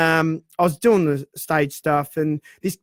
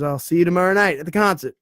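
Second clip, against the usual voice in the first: put-on quick, light American accent; a casual American rock star in an American accent; faster than usual. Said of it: "Rory reckons you got a great American accent".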